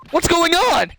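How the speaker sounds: high loud alarmed question; shocked cry. Acted. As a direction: gasp it out in alarm. Voices shocked Maya.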